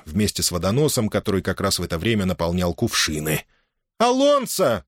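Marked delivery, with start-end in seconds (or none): 4.0-4.8 high-pitched, sharp call of alarm; mother crying out. A narrator's reading. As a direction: cry out the name to halt him. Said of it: "вскричала Маргарита". (возмущённый вскрик, высоко)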